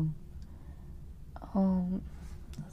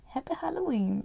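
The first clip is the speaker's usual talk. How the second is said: higher-pitched playful read; exaclamación burlona; 6 semitones higher than usual. Acted. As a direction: say it in a high, teasing pitch. Mock-reading the misspelled word.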